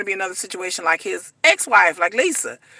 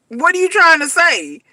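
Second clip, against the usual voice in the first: suspicious question; 5 semitones higher, 3 dB louder than usual. Pretend. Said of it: "So I'm just like".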